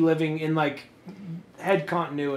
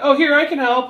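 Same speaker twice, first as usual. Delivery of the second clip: eager helper; 9 semitones higher, 6 dB louder than usual. Retold or imitated. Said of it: "I come in like".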